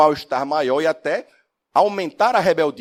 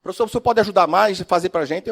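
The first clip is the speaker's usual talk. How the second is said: pleading students; 6 semitones higher, 4 dB louder than usual. Retold or imitated.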